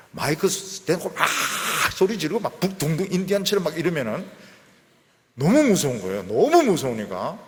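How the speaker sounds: loud, pushing the volume up as if at a shouting service; hammed-up fervour of a noisy prayer meeting; mock loud prayer meeting. (시끄러운 기도회를 흉내내며 크게)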